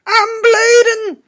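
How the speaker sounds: very high, loud, slow wail; hammed-up wail. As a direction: stretch it out in mock agony. Repeated, higher, slower, far.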